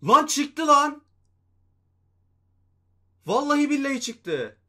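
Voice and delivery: ecstatic shout. Real, not acted. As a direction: scream in triumph. Player finally drops.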